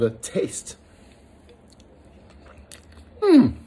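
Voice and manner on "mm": high, loud yummy hum; delighted mmm. Real, not acted. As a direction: savour the first bite. First taste of food.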